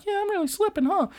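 very high, sheepish squeak; very high girl admitting she's losing; sheepish anime girl. Retold or imitated. Karen answering the taunt.

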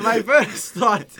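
laughing through the words; giggling restart. Real, not acted. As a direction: laugh as you start the thought. Laughs while restarting his point.

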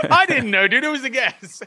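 high, laughing self-defence; laughing protest. Real, not acted. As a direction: protest through real laughter. Laughing defence of lucky guess.

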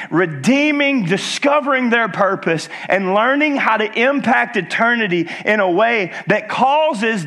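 impassioned preacher in full flow; preaching. Real, not acted. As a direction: proclaim it to the congregation with building fervor. Preaches at rising force.

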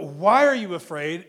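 loud emphatic quote. Real, not acted. Singling out the translation in question.

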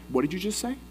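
a soft menacing warning to back off; testy soldier. Acted. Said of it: "He's fiery".

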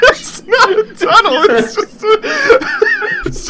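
laughing high through the words. Real, not acted. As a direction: protest while cracking up. Laughing protest.

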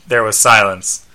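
ordinary delivery, nothing marked

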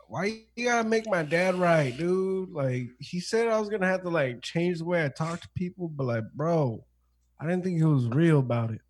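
high-pitched, hammed-up grievance; mock whining. Joins in acting the complaining recruit.

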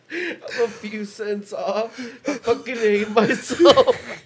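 laughing through tears; squeaky helpless laughter. Real, not acted. Laughing so hard near tears.

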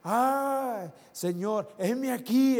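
high, loud crying out to God; Isaiah's cry. Retold or imitated. Prophet calling out to the Lord, loud cry. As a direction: call out eagerly, offering yourself. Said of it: "él dice que gritó".

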